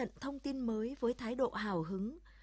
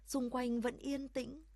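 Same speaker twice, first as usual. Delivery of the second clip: ordinary delivery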